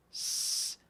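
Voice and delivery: a long voiceless hiss on "S"; "S" as a pure hissing air sound. Shown as a demonstration. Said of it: "¿No lo notan? Que hacen un despido de aire".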